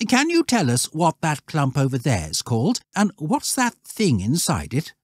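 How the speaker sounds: put-on high, courteous boy's voice; well-mannered lad inquiring; polite schoolboy. Voices Algernon.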